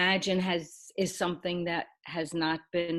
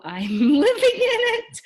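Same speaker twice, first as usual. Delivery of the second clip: laughing; 10 dB louder than usual. Real, not acted. Laughs about living in cabin.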